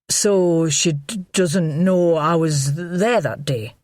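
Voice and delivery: slow, stammering nervous man; worried, tripping on words; anxious man. A narrator's reading.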